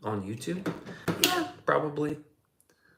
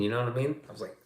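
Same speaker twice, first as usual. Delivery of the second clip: normal talking voice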